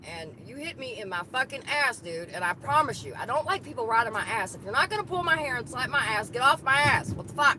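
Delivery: loud, angry yelling at another driver; road rage. Real, not acted. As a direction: rant at the tailgater. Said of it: "road rage just a little bit".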